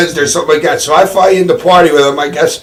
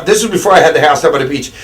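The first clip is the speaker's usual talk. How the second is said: ordinary delivery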